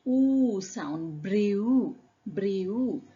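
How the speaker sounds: "Oo" drawn out, then "Brew" ending in oo; oo sound demo. Shown as a demonstration. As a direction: show the final oo in "Brew". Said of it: "what is the last sound you hear".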